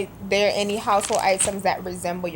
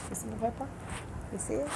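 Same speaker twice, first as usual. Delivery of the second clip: very soft voice, rising question; hushed asking; 3 semitones higher, 16 dB quieter, much faster than usual. Real, not acted. Vlogger shows her question paper to viewers, hushed. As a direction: murmur it close to the camera.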